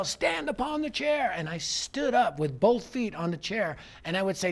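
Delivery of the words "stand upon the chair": high-pitched mock yell; imitated yelling. Teenagers tell the preacher what to do, high imitated yell. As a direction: call out like an eager crowd. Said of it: "And they would yell".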